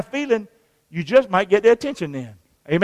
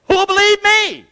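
high-pitched mock protest; acted objection; 9 semitones higher than usual. Reluctant witness making excuses, high protest.

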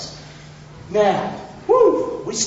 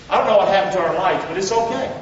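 usual speaking voice

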